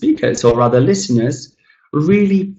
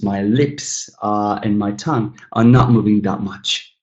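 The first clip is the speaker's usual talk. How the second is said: ordinary delivery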